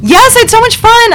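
high, bubbly gush; excited. Real, not acted. Excited answer.

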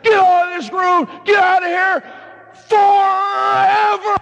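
loud, high yelling, stretching forever; furious shout. Retold or imitated. Priest yelling at a truant student, furious shout. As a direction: chase him out screaming. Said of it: "I still should have yelled at him".

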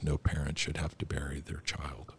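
quiet, hushed quote from a weeping king; grieving king's line. Retold or imitated. A podcast host softly quotes a weeping King Theoden, hushed and grieving. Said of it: "he just crying".

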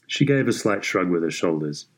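usual speaking voice

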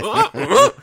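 high, loud ape grunting; mock Donkey Kong grunts. Mocking Donkey Kong heckling a golfer, loud grunts. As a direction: grunt like an angry cartoon ape. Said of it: "Donkey Kong is going".

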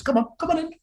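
high, coaxing "come on in"; high playful invitation. Acted. Host luring viewers into the chateau, high and coaxing. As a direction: beckon listeners in a high sing-song.

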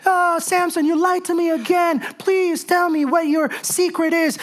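mock crying plea. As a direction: ham up a teary wheedle. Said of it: "she comes back to him crying".

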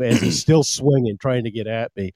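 normal talking voice

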